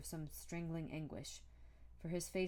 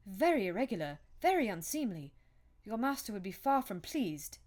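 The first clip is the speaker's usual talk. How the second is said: loud, higher, indignant fussing; peevish; 5 semitones higher, 9 dB louder than usual. A narrator's reading. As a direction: tell the servants off peevishly and loudly. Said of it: "said the lawyer peevishly".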